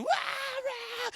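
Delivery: high-pitched mock toddler wail; imitated toddler scream. Dad imitating his son losing the milkshake, high mock scream. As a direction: imitate a small child's scream. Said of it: "and he'd scream".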